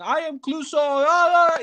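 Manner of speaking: high-pitched mocking take on a shouty actor; strained high parody without full volume; mock shouted Clouseau. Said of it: "he just shouts everything".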